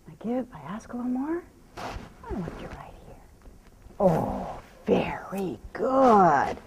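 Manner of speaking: hushed whisper, slowing at the end. Real, not acted. Trainer coaxing the mare close, whispering. Soothing horse during stop.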